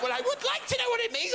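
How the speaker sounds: chirpy mother wanting to know; a high, fast innocent mum; mum's voice. Retold or imitated. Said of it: "And my mum went".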